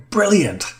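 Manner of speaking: high-pitched, delighted cheer; animated exclamation. A narrator's reading. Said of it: "Emilia exclaimed animatedly".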